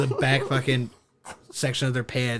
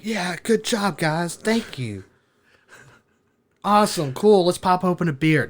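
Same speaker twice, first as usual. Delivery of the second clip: upbeat mock congratulations, pitched up; cheering friends; 7 semitones higher, 5 dB louder than usual. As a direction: imitate pleased friends, higher pitched. Acted cheering of waiting friends.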